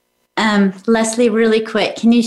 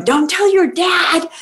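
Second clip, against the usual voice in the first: mock panic; 7 semitones higher than usual. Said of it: "I'm, like, panicking, going".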